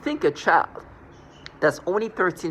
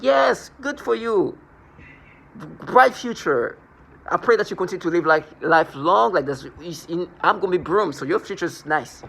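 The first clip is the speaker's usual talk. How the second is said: sarcastic mock blessing; 3 semitones higher, 3 dB louder than usual. Sarcastic reply to accuser.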